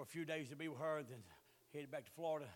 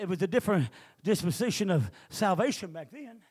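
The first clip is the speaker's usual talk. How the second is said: preaching at full force; 15 dB louder than usual. Real, not acted.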